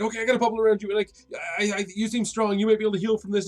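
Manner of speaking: high, stammering mock panic; flustered reassurance. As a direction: nervously reassure someone badly hurt. [name] frantically reassures victim.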